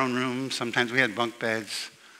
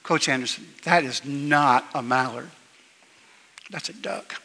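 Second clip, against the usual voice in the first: straight faced. Retold or imitated. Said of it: "straight faced".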